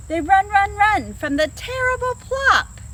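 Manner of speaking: high, loud galloping chant; excited build. A narrator's reading. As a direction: drive the run, run, run. Climax of chase.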